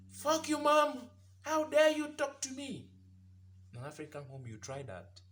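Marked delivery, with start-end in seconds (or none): 0.1-2.9 high, loud bratty outburst; insolent child. Acted. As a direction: shout back at your mother in a high, outraged kid voice. Acts insolent child yelling at mother.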